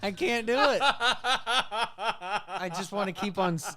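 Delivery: high-pitched talk through laughter; words breaking up in laughter; helpless laughing. Real, not acted. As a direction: try to speak through a real giggling fit. Cracking up at own impression.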